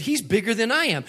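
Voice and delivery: put-on upset teen daughter calling out her father; daughter's accusation. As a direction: scold Dad for leaning in on him. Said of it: "you were trying to intimidate him".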